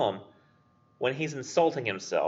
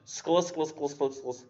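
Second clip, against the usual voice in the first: crisp, clicking "skla" sounds, the first one higher; scuttling crustacean sound; 7 semitones higher, 4 dB quieter, slower than usual. Shown as a demonstration. Teacher imitates the sound of a crustacean scuttling. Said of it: "it sounds like a crustacean".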